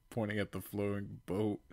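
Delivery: ordinary delivery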